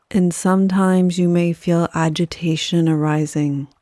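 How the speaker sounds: unhurried yoga nidra guidance, letting each phrase settle; guided meditation. Acted.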